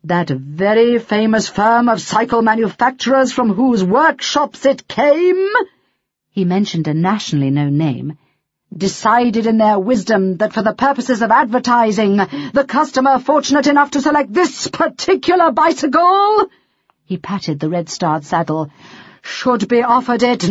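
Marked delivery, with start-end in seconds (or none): 0.1-5.5 building from loud to louder; growing louder. A narrator's reading. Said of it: "his voice growing louder and louder". 8.8-16.5 louder and higher as it builds; growing louder. A narrator's reading. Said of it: "his voice growing louder and louder".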